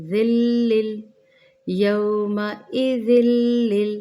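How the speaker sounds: यौमइज़िल लिल with the lams merged, slow and loud; joined lam. Shown as a demonstration. Teacher shows merging the lam in यौमइज़िल लिल. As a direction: blend the ज़ाल into the लाम in यौमइज़िल लिल. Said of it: "फिर लाम को लाम के साथ मिलाएंगे".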